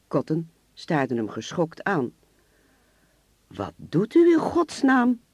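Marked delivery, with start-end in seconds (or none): 3.5-5.2 slow, high, loud horrified cry; shocked man yelling at the attacker; shocked outcry. Acted. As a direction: recoil in shock as the glass breaks. Car window smashed, victim shocked.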